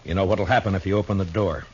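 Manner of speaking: urgent warning. Acted. Marshal urging killer not to leave.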